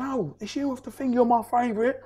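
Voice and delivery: high, thrilled fan voice, lightly done; excited fan lady. Retold or imitated. Lady in the pub recognising him, thrilled fan voice. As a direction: gush like a starstruck fan. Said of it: "She goes".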